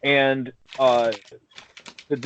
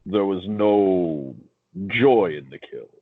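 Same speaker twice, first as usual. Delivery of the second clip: slow, low, flat villain drone; monotone villain voice; 4 semitones lower, much slower than usual. Retold or imitated. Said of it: "He was too monotonous".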